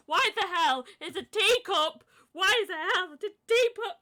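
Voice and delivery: high, loud mock-despairing cry; overdramatic outrage. Co-host baffled by a teacup Pokemon, overdramatic wail. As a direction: have a hammed-up meltdown over something silly. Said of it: "I'm just being overdramatic".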